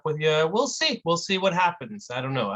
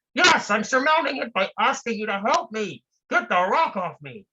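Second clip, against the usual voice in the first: trapped man, impatient; 7 semitones higher than usual. Acted. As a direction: brush off the lecture, just get me out.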